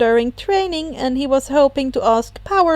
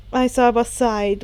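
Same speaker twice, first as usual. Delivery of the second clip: plain reading voice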